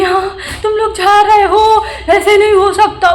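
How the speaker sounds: high, loud mocking imitation of the mother-in-law; nasty mimicking voice. Vlogger mimics landlady's mother rudely, high mocking voice. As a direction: mimic her rude, sing-song taunt. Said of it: "बहुत बुरी, बुरी तरीके से बोल रही थी वो".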